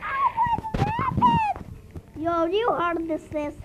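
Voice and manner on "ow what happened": much higher cartoon squeak; squeaky voice. Gamer voicing a startled little character. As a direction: squeak it out in surprise.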